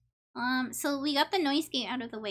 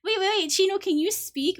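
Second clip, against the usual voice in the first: excited; 6 semitones higher, 7 dB louder than usual. Real, not acted. Calling out to friend excitedly.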